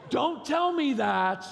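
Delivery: hammed-up indignant shout, high and drawn out; mock overreacting. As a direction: wail it loudly like a drama queen. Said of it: "you're overreacting".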